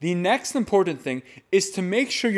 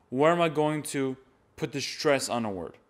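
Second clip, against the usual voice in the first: ordinary talk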